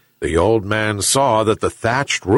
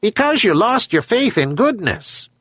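high-pitched talking seabird voice; herring gull; 11 semitones higher than usual. A narrator's reading. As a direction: answer as a gull, pitched well up. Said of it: "answered a herring gull".

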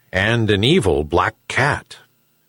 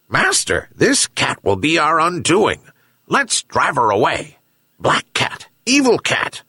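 raised, urgent dog voice; excitable hound, yelping at the end; 6 semitones higher than usual. A narrator's reading. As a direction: warn the master like an agitated dog. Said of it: "yelped the dog".

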